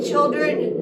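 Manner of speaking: ordinary delivery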